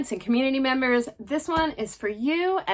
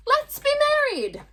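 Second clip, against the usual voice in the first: high worm voice. Acted. Second worm replies in high voice.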